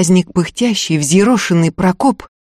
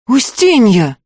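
desperate cry; 3 semitones higher, slower than usual. Acted. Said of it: "в отчаянии выкрикнула Агафья".

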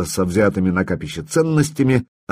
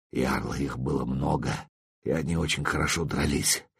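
faint, much softer wounded voice; barely audible; 7 semitones lower, 11 dB quieter than usual. Acted. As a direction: barely breathe the words out. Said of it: "чуть слышно проговорил Свей".

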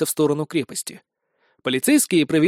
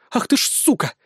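angry snarl; 7 semitones higher than usual. A narrator's reading. Blonde threatening the sergeant, high angry snarl. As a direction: snap at him through the cuffs. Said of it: "рыкнула миниатюрная блондинка".

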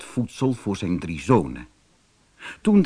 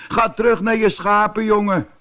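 higher, louder scoffing big-brother voice; mocking laugh; 9 semitones higher, 4 dB louder than usual. A narrator's reading. Older brother scoffs at David, mocking and higher. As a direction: laugh him off as a little shepherd boy. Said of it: "begon te lachen".

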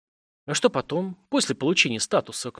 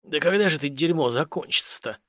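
lightly hoarse, worn-out complaint; a touch of a spent wheeze; wheezed out. A narrator's reading. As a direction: croak it out after a coughing fit. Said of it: "прохрипел".

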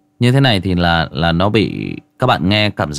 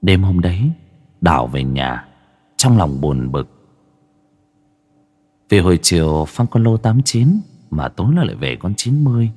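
story narration; 3 semitones lower than usual. Acted. Rereads story with filter on.

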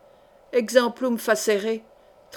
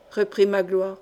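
ordinary delivery, nothing marked

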